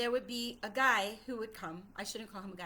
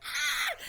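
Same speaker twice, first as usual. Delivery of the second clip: louder, higher re-enacted scream; hysterical scream; 4 semitones higher, 5 dB louder than usual. Child frightened by Papa, re-enacted hysterical scream. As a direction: cry out in mock hysterics. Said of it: "I was in hysterics".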